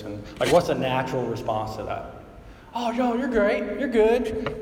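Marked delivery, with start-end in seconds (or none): 2.7-4.6 mock reassurance.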